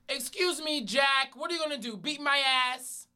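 high-pitched taunting call; calling from far away. Retold or imitated. Taunting the angry man from afar, high calling voice. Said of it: "I said from far away".

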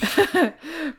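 short high laugh; real laugh. Real, not acted. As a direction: burst out laughing. Reader laughs at shared question.

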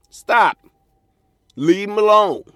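loud, high, forceful command; shouting. Real, not acted. Comic warning women to stay away, shouting. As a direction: yell it so they finally listen.

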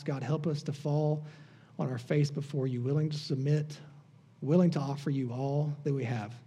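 soft, earnest prayer voice. Real, not acted. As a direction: pray quietly and humbly to God. Pastor prays before scripture.

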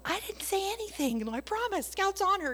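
squeaky imitated mom insisting; high mock mom protest. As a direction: play the startled mother promising.

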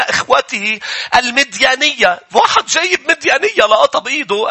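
high and loud scripture preaching; preaching at full force. Acted. Preacher retelling Numbers twenty-five to the church, full force. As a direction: drive the story home to the crowd.

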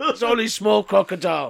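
caveman. Acted. Caveman reassuring the assistant, amused caveman voice. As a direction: caveman talk, half laughing.

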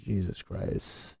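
ordinary delivery

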